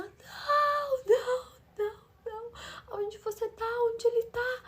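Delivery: voz muito aguda e alta, soluçando não; grito chorado. Retold or imitated. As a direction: imita a cunhada aos prantos em negação. Cunhada pergunta chorando onde ele está. (cunhada grita chorando ao saber que ele faleceu)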